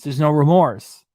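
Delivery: slow emphatic finish; heavy emphasis. Real, not acted. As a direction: land it like a verdict. Building rant about Chantel.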